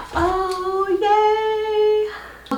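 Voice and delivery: gasp, then a high-pitched yay; high, thrilled little cheer; delighted excitement. Real, not acted.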